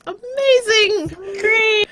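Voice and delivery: real glee at the failed rolls; gleeful excitement. Real, not acted. Players all failed saving throws.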